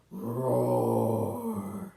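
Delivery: long deep loud roar; lion roar. Pretend.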